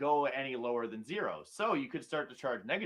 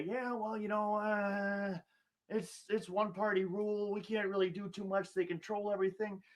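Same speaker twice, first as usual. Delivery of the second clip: slow, higher, softer whiny excuse voice; mock politician excuse; 5 semitones higher, 4 dB quieter than usual. Host mocking Republican politicians' excuses, slow and whiny. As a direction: drag out a limp mealy-mouthed excuse.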